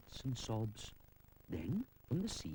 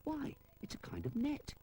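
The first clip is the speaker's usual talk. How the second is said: hushed surprise; 13 semitones higher than usual. A narrator's reading. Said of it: "he cries".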